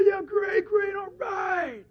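high, drawn-out crowd cheer; high-pitched, stretched-out cheering. Acted. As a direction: whoop it up like a fan.

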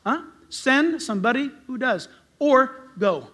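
ordinary delivery

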